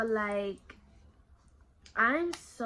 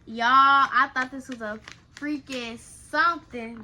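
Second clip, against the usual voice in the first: loud exclaim; 10 dB louder than usual. Real, not acted. Surprised by box contents.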